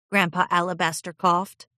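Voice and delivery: ordinary delivery, nothing marked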